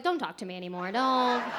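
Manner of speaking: mock offended shutdown. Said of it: "I was like".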